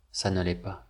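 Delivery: ordinary talk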